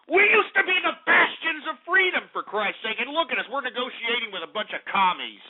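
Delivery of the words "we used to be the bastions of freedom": outraged shout. Acted. Host ranting at listeners.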